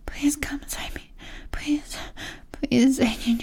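ordinary delivery

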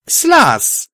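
loud, high-pitched call; high urgent shout. A narrator's reading. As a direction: yell the name across a clearing. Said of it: "I shouted at the dark creature".

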